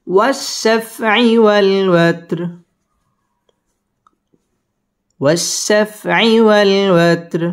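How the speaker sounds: chanted slowly; slow recitation. Shown as a demonstration. Teacher models the phrase slowly.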